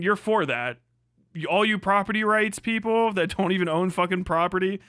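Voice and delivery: usual speaking voice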